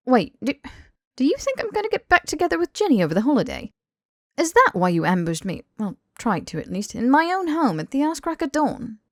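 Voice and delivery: Harry, indignant and flustered. Acted.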